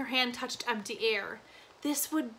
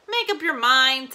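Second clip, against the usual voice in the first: a louder, mildly impatient push; 8 dB louder than usual. A narrator's reading. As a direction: hurry a wavering customer along, a little louder. Impatient shopkeeper pressing hesitant buyer.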